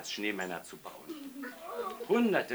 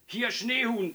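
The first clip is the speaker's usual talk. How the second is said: high, louder voice of a rattled official; agitated, raised pitch; flustered phone answer; 11 semitones higher, 5 dB louder, slower than usual. A narrator's reading.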